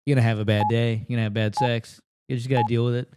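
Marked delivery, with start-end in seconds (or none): none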